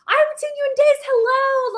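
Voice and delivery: high, bright mock-cheery greeting; cheery husband. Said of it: "He goes".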